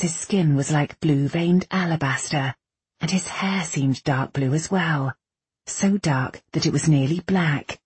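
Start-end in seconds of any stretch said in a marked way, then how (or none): none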